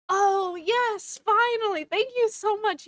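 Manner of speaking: high, loud gushing thanks; effusive relief. Retold or imitated. Dad covering for the kids before the teacher, effusively thankful. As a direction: play up the grateful cover story.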